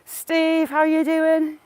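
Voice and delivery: high, loud hail; calling out a greeting. Real, not acted. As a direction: call over to someone passing. Calls a greeting to someone passing.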